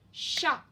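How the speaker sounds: "Chat" pronounced emphatically on its own; sounded-out word. Shown as a demonstration. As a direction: call out "Chat" distinctly for listening practice. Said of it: "Je n'entends pas le son I".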